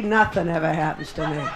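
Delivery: ordinary talk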